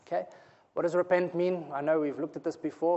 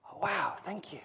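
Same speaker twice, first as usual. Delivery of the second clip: real whisper; 9 dB quieter than usual. Real, not acted. Sudden hushed reaction mid-sermon.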